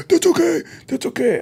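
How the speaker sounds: high, mock-dismissive "that's okay"; lightly mocking the magician's high voice; magician brushing off help. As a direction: squeak it out like a proud little man. Magician declining help, retold.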